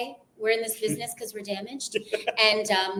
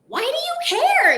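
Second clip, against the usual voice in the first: Friends impression; 7 dB louder than usual. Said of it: "remember the scene".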